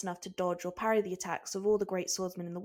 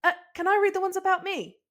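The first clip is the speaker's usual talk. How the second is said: high, eager squeaky voice; excited; 12 semitones higher, 7 dB louder than usual. Acted. Usopp begging Chopper to read his notes, excited. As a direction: bounce with curiosity. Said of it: "Usopp asked excitedly".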